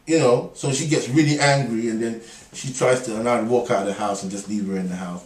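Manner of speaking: ordinary talk, nothing marked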